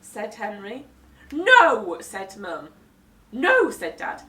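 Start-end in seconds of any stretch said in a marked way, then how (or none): 1.3-2.0 louder flat refusal; firm no. Acted. Mum refusing Henry's show, loud firm no. 3.3-3.7 louder, higher refusal; firm no. Acted. Dad refusing Henry's show, loud no.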